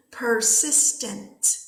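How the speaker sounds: "persistent" drawn out very slowly and clearly; model pronunciation. Shown as a demonstration. Teacher has students say it again, slow careful pronunciation.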